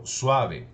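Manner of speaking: "suave" with the A leaned on; stress on the A. Shown as a demonstration. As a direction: punch the A in "suave", falling after it. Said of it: "como si tuviera el acento en la A".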